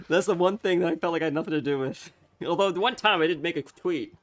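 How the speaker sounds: giggly disbelief at the joke; amused, half laughing. Real, not acted. Laughing about the Putin tweet.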